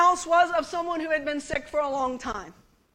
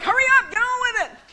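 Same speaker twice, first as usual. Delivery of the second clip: high, pushy hurry-up voice; old lady hurrying the young missionary along; impatient old lady; 8 semitones higher, 3 dB louder than usual. Retold or imitated. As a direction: nudge him along, raised. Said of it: "It was like".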